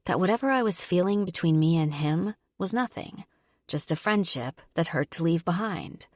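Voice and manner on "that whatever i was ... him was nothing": ordinary delivery, nothing marked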